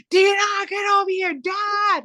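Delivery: high-pitched imitation yell for Dad; retold screaming. Sibling imitates buried brother screaming for Dad, high yell. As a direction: holler it way up high, mimicking a stuck kid. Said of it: "he would start screaming".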